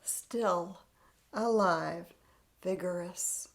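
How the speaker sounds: ordinary delivery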